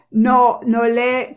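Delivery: usual speaking voice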